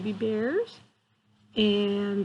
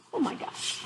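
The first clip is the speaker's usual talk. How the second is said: whispered amazement; 5 semitones higher, 6 dB quieter than usual. Real, not acted. Reacting to the true story.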